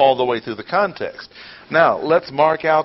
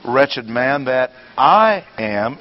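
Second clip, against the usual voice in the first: I drawn out and punched before am; slowly, with a heavy I; stress on I; 4 dB louder, slower than usual. Shown as a demonstration. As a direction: lean hard on I. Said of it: "emphatic pronoun".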